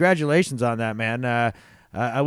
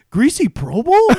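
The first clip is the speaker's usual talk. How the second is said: excited; 10 semitones higher, 6 dB louder than usual. Retold or imitated. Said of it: "He's like".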